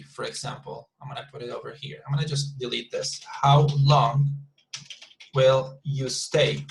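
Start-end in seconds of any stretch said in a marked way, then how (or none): 3.3-6.6 word by word with long pauses; measured, spaced-out model sentence; slow dictation. Shown as a demonstration. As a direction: dictate it slowly for a class to follow. Teacher dictates example while writing it.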